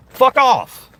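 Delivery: high, loud snap at the trolls; angry shout. Real, not acted. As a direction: bark it at the hecklers.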